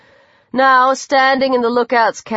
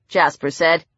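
ordinary delivery

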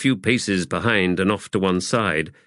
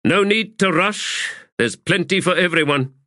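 higher-pitched call to the ranks; raised voice; 10 semitones higher than usual. A narrator's reading. High Fist regaining composure before the sappers, voice mildly raised. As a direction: lift your voice to address everyone. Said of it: "and then raised his voice".

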